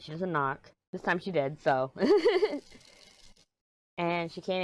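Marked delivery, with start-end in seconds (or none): 2.0-2.6 quick high burst of laughter; short laugh. Real, not acted. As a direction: laugh it off, amused. Laughing at daughter knocking.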